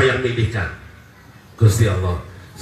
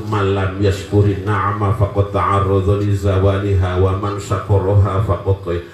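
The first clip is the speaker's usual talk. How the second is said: Arabic recitation; 6 semitones lower than usual. Acted. Recites Arabic verses and Hikam.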